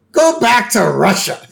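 a hint of a drunk yelling; lightly voiced drunk yell; mock Barney yell. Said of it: "Barney yell".

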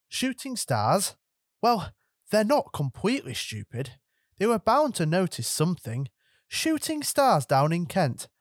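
a strict teacher scolding careless wizards; stern professor. Acted. McGonagall's voice in the story.

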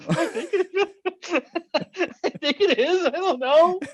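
high voice cracking into laughter, mock-shaken; mock quivering lips. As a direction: play a rattled skier laughing through the doubt. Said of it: "my lips started quivering".

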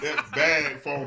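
slow, laughing through the words; amused laugh on bag phone. Real, not acted. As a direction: chuckle out the line.

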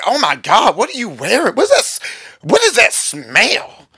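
high, swooping, gushing woman's voice; imitated admiring women. Women hugging pastor ask about scent, gushing imitation. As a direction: swoon over it with big pitch swoops.

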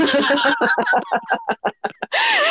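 long high laugh; real laughter. Real, not acted. Laughing at friend's hose-her-down joke, real laughter.

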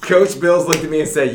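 normal talking voice

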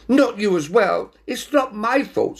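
high-pitched protest; exasperated Binnicky. A narrator's reading. Cat protests at whisker remark, high and upset. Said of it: "said Binnicky".

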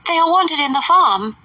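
"They are wanted in the farm" high and insistent; high fussy mother; Mrs Bennet. A narrator's reading. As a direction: push the mother's pitch up on "farm".

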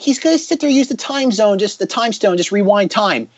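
high-pitched, incredulous retort; exasperated objection. Retold or imitated. Viewer objecting to the film's plot, exasperated and high. Retelling own objection to the film.

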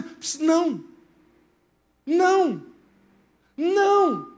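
God gently refusing; God's voice saying no. Retold or imitated. God warning the preacher against his plan, lightly voiced. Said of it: "comecei a ouvir ele dizendo".

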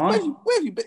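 very high-pitched disbelief; incredulous. Real, not acted. Teasing someone who has missed Baby Yoda, incredulous. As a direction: squeak it out, amazed they missed it.

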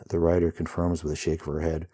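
ordinary delivery

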